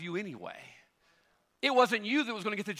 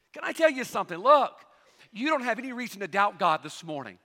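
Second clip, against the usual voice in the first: ordinary delivery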